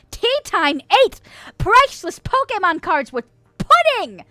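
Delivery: high, loud, theatrical proclamation; mock denunciation. Church host exposing Tea Time to chat, mock-outraged. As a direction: announce the crime to the whole congregation, hammed-up. Publicly denouncing the confessed culprit.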